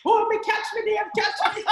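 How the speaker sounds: high, laughing mock shout; Jamaican outburst. Wife acting out leaping off and calling to husband, high mock shout. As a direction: yell it in playful Jamaican panic. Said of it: "I think that's the Jamaican part of me".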